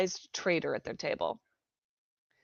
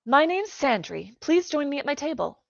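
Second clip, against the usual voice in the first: put-on young girl voice; 8 semitones higher, 3 dB louder than usual. Friendly young girl invitation.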